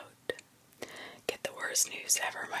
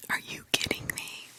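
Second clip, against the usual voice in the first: ordinary delivery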